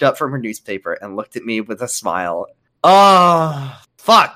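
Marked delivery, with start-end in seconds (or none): 2.8-4.4 loud startled swear; shocked curse. Real, not acted. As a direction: blurt it out in shock. Shocked outburst reading.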